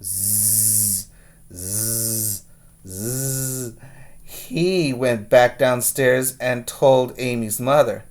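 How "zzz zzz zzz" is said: quiet, low snores through the nose; girl snoring away in bed; soft snoring. Acted. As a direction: snore softly in deep sleep. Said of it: "Amy snored".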